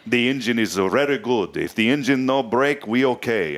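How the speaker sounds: hammed-up Italian-accented broken English; bad Italian accent. Host imitating an Italian ship engineer, bad Italian accent. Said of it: "that was terrible accent".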